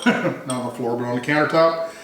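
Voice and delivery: usual speaking voice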